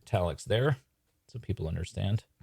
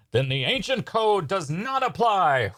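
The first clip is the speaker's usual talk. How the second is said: high, slow triumphant declamation; grand proclamation; 12 semitones higher, 5 dB louder, slower than usual. Acted. Relieved magician declaring the ancient code void, grand. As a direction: announce it like a pompous stage magician.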